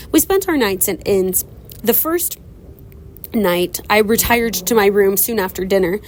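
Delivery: usual speaking voice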